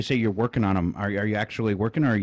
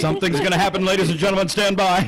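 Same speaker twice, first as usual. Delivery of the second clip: high, excited announcer build-up; frantic mock sports commentary; 7 semitones higher than usual. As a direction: hype it like a ringside announcer. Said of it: "a sports commentator voice".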